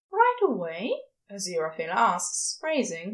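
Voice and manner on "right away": lightly raised, gentle angel voice; Aziraphale's voice. A narrator's reading. Aziraphale asking Crowley, in a light higher voice.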